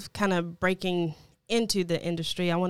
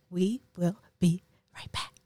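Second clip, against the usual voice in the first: whispering; 3 semitones lower than usual. Real, not acted. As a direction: whisper it like a secret. Host teasing break, whispered.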